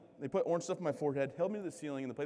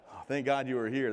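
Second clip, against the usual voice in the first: ordinary talk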